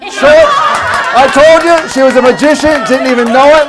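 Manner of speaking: loud, high, thrilled hype; excited cheering. Real, not acted. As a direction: whip the crowd into applause. Volunteer's trick succeeds, cheering.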